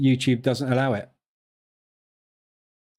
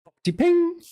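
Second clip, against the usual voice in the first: much higher, sing out "Poppety ping" like an oven bell; high-pitched "Poppety ping", playful sound imitation; imitated microwave noise. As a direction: mimic the microwave's chime on "ping". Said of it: "they call it the noise it makes".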